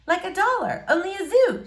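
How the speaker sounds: put-on shy girl greeting a boy she likes.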